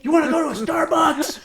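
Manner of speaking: put-on high kid voice, breezy, shouting over the bike; squeaky casual kid. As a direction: mimic a squeaky kid asking about coffee at top speed. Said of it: "he's just like".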